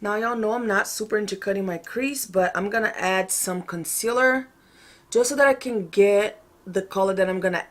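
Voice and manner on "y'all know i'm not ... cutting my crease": ordinary talk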